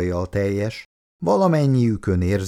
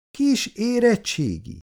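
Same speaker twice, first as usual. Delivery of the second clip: high, slow, outraged echo; indignant surprise; 14 semitones higher than usual. Acted. Schoolgirl echoing a classmate's words in high, slow indignation. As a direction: repeat it as if insulted. Icu jerks her head up.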